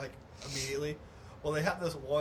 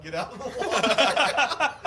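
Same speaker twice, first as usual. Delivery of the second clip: lifeguard shout; 7 semitones higher, 10 dB louder, slower than usual. Retold or imitated. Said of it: "the lifeguards are like".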